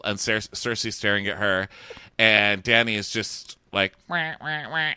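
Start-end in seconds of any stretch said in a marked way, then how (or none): 4.1-4.9 high, softer mock wah; higher-pitched pretend wahs; imitated wah-wah noise. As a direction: voice a repeated little wail.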